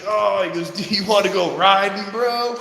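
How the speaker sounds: high, loud mock of a boastful neighbor; proud neighbor. Moped rider inviting his buddy out, hammed-up proud. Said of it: "He was, like, all proud of himself".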